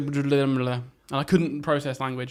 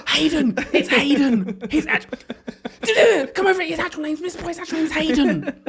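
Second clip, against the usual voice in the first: high, loud thrilled discovery; excited kids shouting; 12 semitones higher, 4 dB louder than usual. Retold or imitated. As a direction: shout the secret like delighted children.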